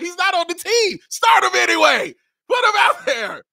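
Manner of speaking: high-pitched, loud shouting, hammed up; mock fan outburst at full volume; screaming fans. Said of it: "People out there were screaming".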